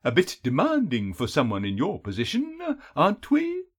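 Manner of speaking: mocking, swooping, drawn-out taunt; chuckle, then tease with high lilting ends; amused captor toying with his prey; throaty chuckling villain. A narrator's reading. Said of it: "A throaty chuckle followed".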